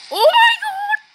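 high-pitched, loud squeal of shock; startled squeal. Real, not acted. Player reacting to the game, startled squeal.

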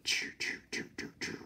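soft unvoiced choo sound effects; whispered slashing noises. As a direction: mimic quick claw swipes. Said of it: "He's like".

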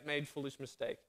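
ordinary talk

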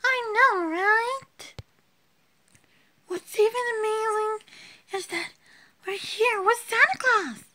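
high-pitched play voice, giddy; squeaky doll voice. Acted. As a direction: squeak it like a little toy friend. Second doll voiced at mall.

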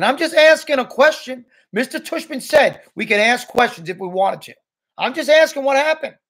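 high, loud self-justifying; defensive protest. A narrator's reading. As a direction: argue back at a high pitch, insisting. Julian defends himself in the quarrel.

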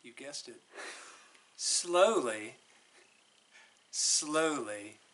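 ordinary delivery

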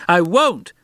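put-on a boy refusing at the top of his voice; near shriek. A narrator's reading. Boy defying the warning, almost shrieking. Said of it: "Harvey almost shrieked".

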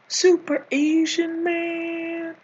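slow, stretched-out hero title; grand title announcement. Acted. Comedian unveiling his comic book title, grand announcement. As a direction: draw out the last word like a superhero's name.

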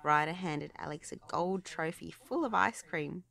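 usual speaking voice

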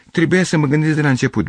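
high-pitched sudden realisation; exclaiming. A narrator's reading. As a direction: burst out as it dawns on you. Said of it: "exclamă el".

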